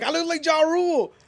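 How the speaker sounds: quoted high and far from his own voice, bragging; high mock brag; mock boast. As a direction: mimic a guy bragging about his looks. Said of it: "He's like".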